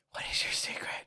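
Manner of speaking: very soft, secretive whisper. Acted. Friend questioning the ageless ten-year-old, whispered. Echoing whispered taunts to characters.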